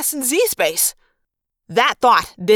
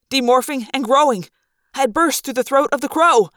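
ordinary delivery, nothing marked

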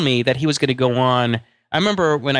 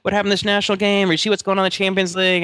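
eager soccer fans; 7 semitones higher than usual. Retold or imitated. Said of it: "they're like".

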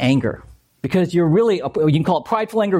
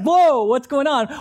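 mock startled; 8 semitones higher than usual. Other person reacting to blowup.

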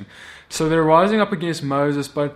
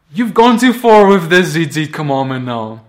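loud, fed-up objection; indignant protest; 10 dB louder than usual. Acted. Elders protesting Moses' commandment, indignant. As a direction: push back hard with raised volume.

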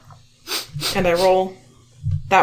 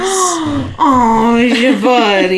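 cooing; 3 semitones higher, 4 dB louder, much slower than usual. Acted. Cooing over the dog.

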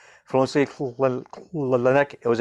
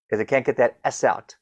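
usual speaking voice

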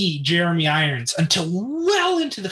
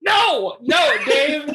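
outraged no; 9 semitones higher, much slower than usual. Real, not acted. Friend disses his favorite film.

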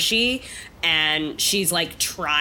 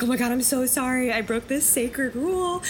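higher, hushed mortified apology; mock horrified apology; 7 semitones higher than usual. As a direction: gasp it out, caught breaking the rules. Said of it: "have this face like".

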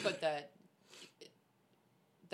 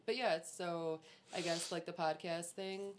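ordinary delivery

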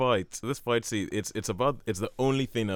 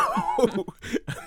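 high laugh at his own bit; high laugh breaking through; amused laugh. Real, not acted. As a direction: burst out laughing. Laughing after the impression.

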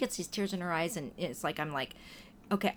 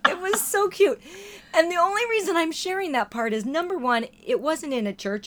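higher and louder, still giggling; laughing delight; 9 semitones higher, 6 dB louder than usual. Real, not acted. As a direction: talk through leftover laughter.

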